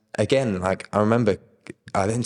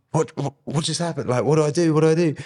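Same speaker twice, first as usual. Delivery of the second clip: panicked what-do-I-do, replayed; retold disbelief; 10 semitones higher than usual. Retelling the healing moment.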